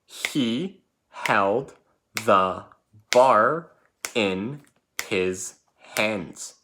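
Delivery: a teacher saying the sentence together with the class, very slowly; slow choral reading. Shown as a demonstration. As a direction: lead the class through it slowly, one word at a time. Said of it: "let's say it together".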